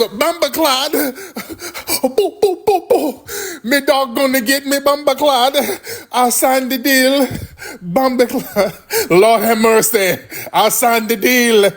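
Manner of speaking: high, frantic mock Jamaican patois; mock Jamaican panic. Mocking runaway Jamaicans fleeing the dogs, high panicked Jamaican accent. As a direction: squeal it like a terrified Jamaican running from dogs.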